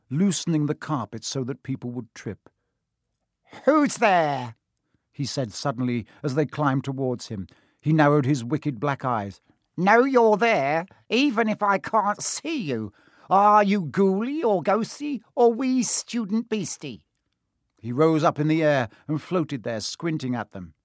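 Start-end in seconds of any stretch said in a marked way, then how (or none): 3.6-4.6 high, loud, cackling ghost voice; a sly spirit calling into the dark; mischievous poltergeist. Acted. Peeves poltergeist voice. 9.8-17.0 high, sing-song teasing ghost; taunting poltergeist. Acted. Peeves taunting unseen students.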